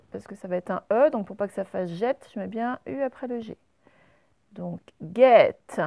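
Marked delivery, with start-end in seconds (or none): 5.0-5.8 guette loud and slow on the è; careful teaching guette; sound demo. Shown as a demonstration. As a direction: lean on the è in guette. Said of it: "Le est, il est avant te".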